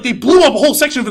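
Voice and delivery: ordinary talk, nothing marked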